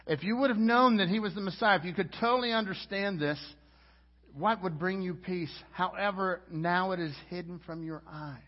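loud, high, fervent sermon climax; impassioned preaching. Acted. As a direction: preach it full force over Jerusalem. Preaching at full force.

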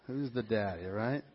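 a low, hushed repeat of the headline; quiet aside. Retold or imitated.

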